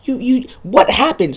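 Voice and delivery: high, loud, confused outburst; mock angry yell. Player yelling at the character, in a high, loud mock rage. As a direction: shriek it in mock rage, then lose the thread. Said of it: "And it's just like".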